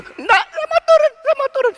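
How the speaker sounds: put-on very high, tearful toddler plea; imitated scared child. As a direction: beg in a squealing child's voice. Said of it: "Terus dia mulai takut". (tiruan anak ketakutan, suara tinggi melengking)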